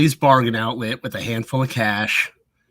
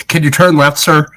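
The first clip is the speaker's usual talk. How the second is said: mock police order; 3 semitones higher, 10 dB louder than usual.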